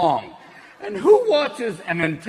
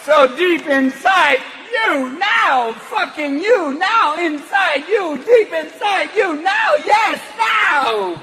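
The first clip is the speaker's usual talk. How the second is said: Christopher Walken; 5 semitones higher, slower than usual. Retold or imitated. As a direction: pause oddly and punch words slowly. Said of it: "Chris would be up there going".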